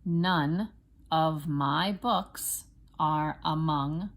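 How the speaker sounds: slow, separated words with clear stresses; teacher's deliberate word-by-word reading; spelling dictation. Shown as a demonstration. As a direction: dictate it slowly for first graders to write. Teacher dictates for children to write.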